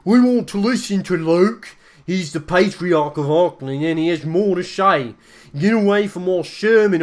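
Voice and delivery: loud, high heckling in a changed voice; furious believer shouting down the Inquisition; angry crowd shout. Acted. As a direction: yell it back at the preachers. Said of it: "some even shout angrily".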